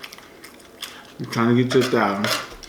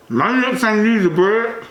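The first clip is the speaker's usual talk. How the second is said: chewing while talking; mouth full; 7 semitones higher, slower than usual. Real, not acted. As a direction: talk through a mouthful. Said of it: "talking with my mouth full".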